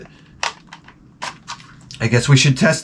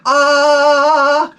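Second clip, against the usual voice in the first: realization; 12 semitones higher, 3 dB louder than usual. Retold or imitated. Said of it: "it was like".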